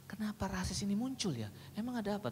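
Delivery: soft, unvoiced, curious wondering; whispered mock-innocent curiosity to draw the teen out; pretend puzzled whisper. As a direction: play dumb and wonder aloud in a whisper. Feigned innocent questioning.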